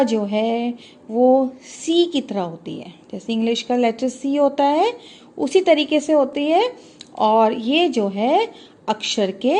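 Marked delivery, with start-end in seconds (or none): none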